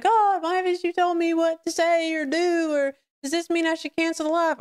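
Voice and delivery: high-pitched mock wail to God; imitating a desperate, whiny prayer; retold tearful plea. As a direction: plead upward as if in tears, half acted. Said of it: "while I was ugly crying".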